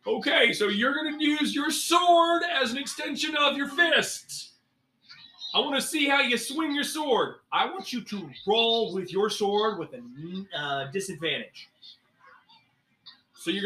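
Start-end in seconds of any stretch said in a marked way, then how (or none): 0.1-9.8 high, lightly Farley-tinged instructor voice. Acted. DM as instructor Grim challenging the ranger to stab him. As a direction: challenge the student to attack you.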